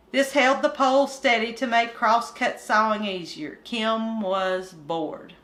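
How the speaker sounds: put-on lively read-aloud to children; story narration. Reader opening the coon hunt chapter, storytelling voice. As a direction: tell it like a bedtime yarn.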